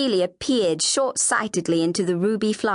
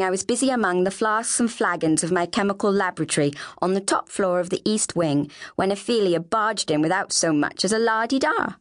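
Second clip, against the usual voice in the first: usual speaking voice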